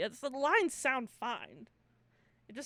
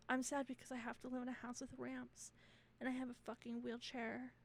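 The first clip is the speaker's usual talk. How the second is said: soft, subdued mock sob story; mock sad; 10 dB quieter than usual. Wheelchair-using friend claiming the brownie, mock sad. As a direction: play the woeful friend quietly.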